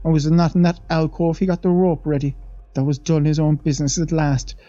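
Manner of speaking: a lightly voiced old maid, awed; old Irish housemaid. The maid leans in to the young men, lightly voiced and awed. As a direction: tell it like a wide-eyed servant gossiping.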